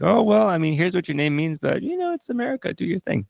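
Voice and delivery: high, easygoing dad letting his son go; voicing the dad. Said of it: "his dad's able to say".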